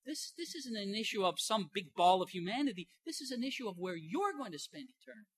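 lightly voiced Jesus, singling out each listener; a hint of a stern Jesus, one listener at a time; Jesus pointing at each one. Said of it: "as if he's just looking at each individual".

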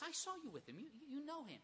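lightly voiced girl pressing Peter; a hint of a young servant girl accusing. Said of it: "a servant girl comes and says".

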